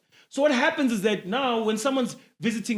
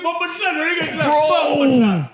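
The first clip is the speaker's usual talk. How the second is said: put-on Jamaican accent; 4 semitones higher, 3 dB louder than usual. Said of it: "Jack Mama's was saying".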